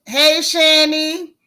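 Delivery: thrilled host hailing a regular; loud sing-song hello. Real, not acted. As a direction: call her name out like across a room. Excited greeting of a viewer.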